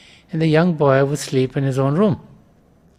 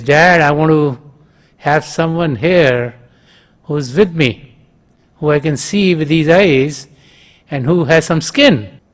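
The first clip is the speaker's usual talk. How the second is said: usual speaking voice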